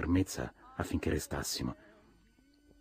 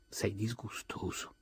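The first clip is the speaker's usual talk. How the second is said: slow whisper full of contempt; disgusted whisper; 5 semitones higher, 7 dB quieter, slower than usual. Acted. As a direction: breathe it out slowly in disgust. Daisy disgusted by Tom.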